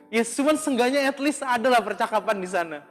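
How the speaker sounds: ordinary delivery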